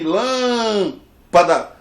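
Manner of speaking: "lâmpada" with LÂM held very long and loud; sílaba forte alongada. Shown as a demonstration. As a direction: draw out the first syllable of "lâmpada". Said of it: "a sílaba forte é aqui". ("lâmpada" com o LÂM forte e bem esticado)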